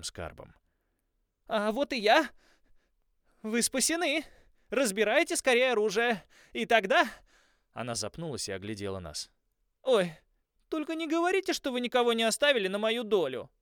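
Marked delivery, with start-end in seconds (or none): 1.5-7.1 high, loud and breathless with excitement; excited girl. Acted. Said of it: "взволнованная Холли". 9.8-13.5 high, loud and breathless with excitement; excited girl. Acted. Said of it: "взволнованная Холли".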